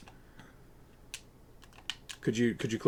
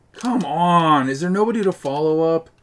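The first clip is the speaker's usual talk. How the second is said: louder, higher yell at the team; frustrated shout; 5 semitones higher, 8 dB louder than usual. Real, not acted. Gamer yelling at teammates who won't follow up, frustrated shout. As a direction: yell in exasperation.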